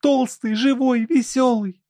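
high, loud female exclamation; joyful outcry. A narrator's reading. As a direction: cry it out joyfully as the wife. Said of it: "вскричала Елена Никитишна".

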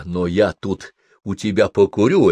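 usual speaking voice

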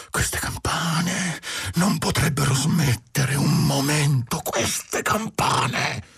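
slow, high wail of anguish. A narrator's reading. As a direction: plead in torment, drawing the words out high. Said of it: "ripetendo con angoscia".

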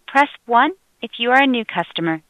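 ordinary talk, nothing marked

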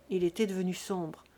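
ordinary delivery

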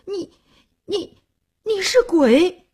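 halting, high-pitched frightened stammer; trembling with fear. A narrator's reading. Terrified groom to his bride, trembling and stuttering. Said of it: "张秀林浑身颤抖地说".

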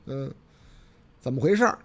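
ordinary talk, nothing marked

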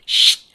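short, loud hushing hiss; hissed shush. A narrator's reading. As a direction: cut the noise with a hiss. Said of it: "sibilò la signora Brill".

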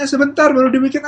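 high, loud stalling reply; harried mother. Acted. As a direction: act a mum putting off a nagging child. Acted mother answering demanding child. (ibu menjawab dengan nada tinggi)

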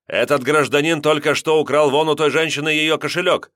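громко и выше, на весь автобус; объявить всем пассажирам; звонкий крик. A narrator's reading. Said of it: "звонко крикнул". (Семёнов громко объявляет о краже)

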